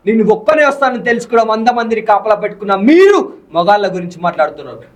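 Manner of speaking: fiery hero dialogue performed for the interviewer; hero punch dialogue. Shown as a demonstration. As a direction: spit out the challenge like a mass film hero. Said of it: "ఇప్పుడు కూర్చొని చెప్పాలంటే".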